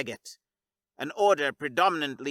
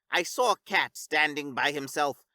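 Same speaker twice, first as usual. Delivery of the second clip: ordinary delivery, nothing marked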